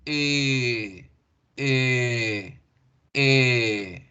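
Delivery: "E" drawn out long and rounded; lengthened E. Shown as a demonstration. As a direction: stretch the rounded E. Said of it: "Y la alargo ahora".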